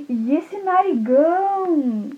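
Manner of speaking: high, slow, wondering; amazed little girl. Acted. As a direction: stretch it out in amazement. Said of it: "ela falou assim".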